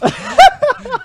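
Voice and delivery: high happy shriek of laughter. Real, not acted. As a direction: burst into a squealing laugh. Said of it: "shrieked like a happy girl".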